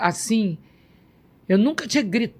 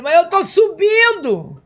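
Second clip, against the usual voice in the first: amazed exclamation; 9 semitones higher, 4 dB louder, slower than usual. Retold or imitated. Retold amazement while rising.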